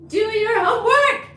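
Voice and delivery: high loud pretend-parent order; mock scolding. Streamer jokingly ordering a viewer to study, mock scolding.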